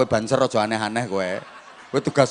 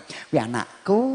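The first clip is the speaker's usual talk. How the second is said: proud mother. Retold or imitated. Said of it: "ibuke kok seneng".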